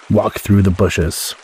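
usual speaking voice

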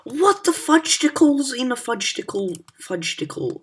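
high, loud made-up curse; real exasperated outburst; silly outraged cry. Real, not acted. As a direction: blurt the nonsense swear in outrage. Outburst at the game.

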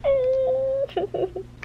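high-pitched little whimper; high whimpering whine. Real, not acted. Reader sad for the hurt character, whimpering. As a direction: whine with pity, nearly crying.

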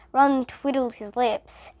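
ordinary delivery, nothing marked

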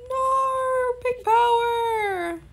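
high, slow, drawn-out whine; mock mournful wail. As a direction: stretch it into a pretend heartbroken wail. Mock despair, fading.

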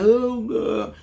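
high-pitched mock wail; mock crying. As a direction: imitate someone crying out in distress. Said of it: "Got you crying".

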